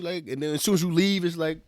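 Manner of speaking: ordinary delivery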